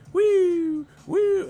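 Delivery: very high mock woo; mocking Eddie Hearn. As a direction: squeal it up like hype. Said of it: "Eddie Hearn was like".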